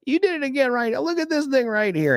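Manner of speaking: student teasing her teacher, high mock voice; mock schoolgirl accusing. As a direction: needle the teacher in a high put-on girl voice. Student voiced in retold story.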